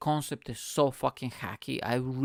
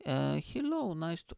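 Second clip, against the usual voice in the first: very high, fluting voice of the Queen; mock-royal high greeting; Queen impression; 10 semitones higher than usual. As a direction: imitate the Queen greeting a guest in a high-pitched coo. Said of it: "I need to imitate the Queen now".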